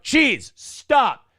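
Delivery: retold group shout at Cheese; mock crowd shout. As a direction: imitate a crowd yelling at a friend. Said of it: "you just hear a dozen people shout".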